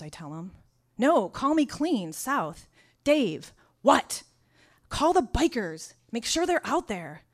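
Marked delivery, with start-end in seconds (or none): 1.0-2.7 high, loud, slow dispatcher voice; a loud, high dispatcher on the phone. Retold or imitated. 3.0-4.2 loud, high, baffled shout; a loud, high confused shout. Retold or imitated. 4.9-7.2 a loud, urgent phone demand. Retold or imitated.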